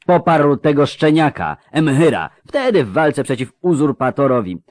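mounting indignation of an affronted father; an acted, restrained roar of protest; booming, roaring outrage. A narrator's reading. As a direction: build through outraged rhetorical questions. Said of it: "zaryczał ten basowy dudniący".

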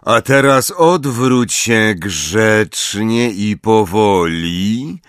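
slow, drawn-out menacing drawl; slow motion speech. A narrator's reading. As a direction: stretch every syllable menacingly. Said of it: "przeciągając sylaby".

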